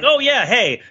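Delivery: usual speaking voice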